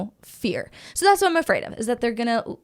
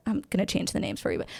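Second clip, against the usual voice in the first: ordinary delivery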